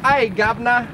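high, hammed-up cockney voice; a terrible Mary Poppins chimney-sweep cockney; lively, deliberately awful London cockney; bad cockney accent. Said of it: "That's my impression".

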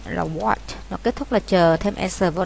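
ordinary delivery

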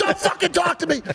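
high-pitched furious snapping; retold angry shout. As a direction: imitate an enraged dad turning on you. GP turns his rage on speaker.

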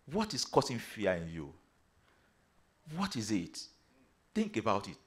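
low, soft questioning; quiet probing. Real, not acted. Preacher asking the brethren about their fear, quiet and probing. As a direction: drop low and let them reflect.

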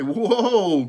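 loud, high drawn-out halt call; urgent long shout to horses. A narrator's reading. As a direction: holler to stop runaway horses. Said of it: "called Father".

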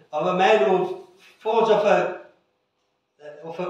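normal talking voice

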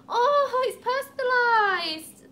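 high, loud delighted exclamation; thrilled gushing. Real, not acted. Vlogger seeing the gift is personalised, thrilled. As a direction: gush slowly over a lovely surprise.

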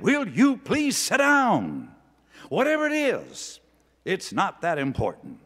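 a stern preacher's loud public scolding; stern booming rebuke. Retold or imitated. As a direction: bark it at the man like an angry preacher. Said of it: "big, deep, booming bass voice".

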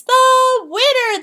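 slow, high, loud proclamation; Emperor declaring. Acted. Jade Emperor announces the race winner, grand declaration. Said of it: "the Jade Emperor declared".

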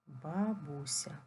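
slow, syllable by syllable "Бабуся"; "Бабуся" stretched out for writing down; slow dictation. Shown as a demonstration. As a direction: dictate "Бабуся" slowly, each syllable drawn out. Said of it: "запишіть з великої букви слово бабуся".

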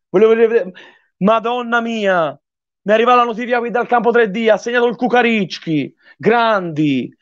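high-pitched, overjoyed; elated praise. Real, not acted. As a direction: gush over the team. Still celebrating the goal.

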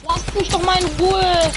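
ordinary talk, nothing marked

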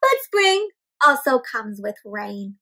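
usual speaking voice

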